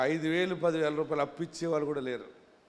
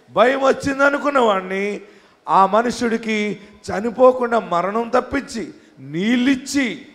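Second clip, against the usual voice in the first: fiery preaching; 2 semitones higher, 10 dB louder, slower than usual. Acted. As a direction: preach it with full force to the congregation. Preaching at full force.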